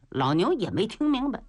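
usual speaking voice

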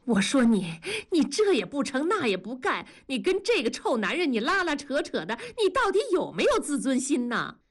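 high-pitched exasperated scolding. A narrator's reading. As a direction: berate a friend who keeps disappointing you. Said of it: "文丽恨铁不成钢地说". (恨铁不成钢地数落)

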